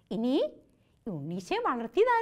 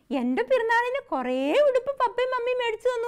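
put-on high, louder child bragging; little girl boasting; 9 semitones higher, 4 dB louder than usual. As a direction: voice the small girl showing off. Girl boasts to her friend.

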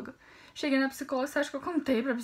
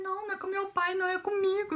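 imitated tearful plea, higher pitched; lightly mock crying, voice pitched up; retold tearful confession; 7 semitones higher than usual. Crying girl telling her mother it's about her, retold tearful confession. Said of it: "Eu tava chorando".

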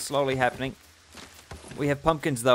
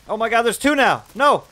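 panicked shriek; 9 semitones higher, 10 dB louder than usual. Real, not acted. Second spider attacks.

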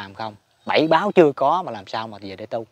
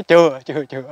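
a lightly voiced deity saying not yet, louder; firm, loud refusal; Địa Tạng refusing; 7 dB louder than usual. Địa Tạng tells the brother not yet, firm and loud. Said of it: "Ông nhìn lại ông nói".

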